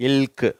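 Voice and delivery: இல்க் as one joined l-k sound; falling இல்க் with both consonants heard; LK sound demo. Shown as a demonstration. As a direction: model இல்க், l running straight into k. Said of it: "இல்க் அப்படிங்கிற sound வருது".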